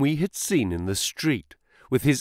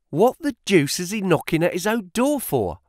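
high, startled cry of bafflement; puzzled cry; 7 semitones higher than usual. Acted. Clerk hearing the knock, baffled cry. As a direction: exclaim at the strange knocking. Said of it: "cried the clerk".

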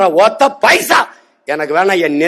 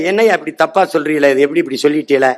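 lower, quick mocking whine; mock whiny complainer; 5 semitones lower than usual. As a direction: imitate a whining critic with lowered pitch. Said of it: "அவர்கிட்ட கேள்வி கேட்டீன்னு வச்சுக்க".

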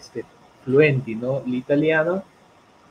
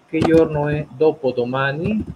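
loud, slow teacher's question; measured, projected classroom prompt; slow modelling; 3 semitones higher, 7 dB louder than usual. Shown as a demonstration. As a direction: spell the question out by ear for a learner, rising at the end.

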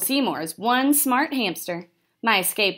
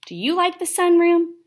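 eager question; 9 semitones higher, 5 dB louder than usual. Acted. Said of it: "and I said".

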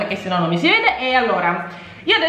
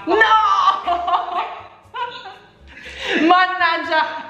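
slow, drawn-out, higher groan; mock despair; 8 semitones higher, slower than usual. Real, not acted. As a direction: ham up the bad luck. Unlucky pick, exaggerated dismay. (finta disperazione)

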